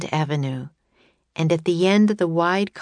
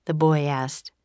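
plain reading voice

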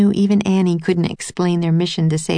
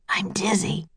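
soft, mildly winded; weakly, short of breath; catching his breath; 3 semitones higher, 7 dB quieter than usual. A narrator's reading. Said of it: "trying to catch his breath".

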